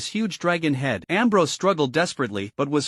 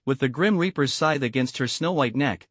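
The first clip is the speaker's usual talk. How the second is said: usual speaking voice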